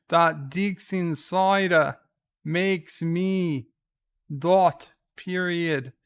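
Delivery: hammed-up Australian accent, slow on the web address; Aussie voice. Streamer doing the cider slogan in an Aussie voice, loud mock Australian. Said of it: "I can do it in Aussie voice".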